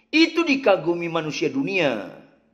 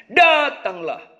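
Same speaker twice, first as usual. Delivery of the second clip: "Datanglah" said higher and firmly with a falling close; emphatic call; 7 semitones higher, slower than usual. Shown as a demonstration. Preacher ending his point with a firm call. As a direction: punch "Datanglah" as the climax. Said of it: "Yesus Tuhan kita".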